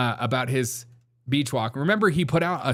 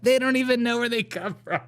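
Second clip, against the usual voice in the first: higher, cartoonish mock tone; 11 semitones higher than usual. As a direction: ham up the cluelessness. Mocking the clueless search results.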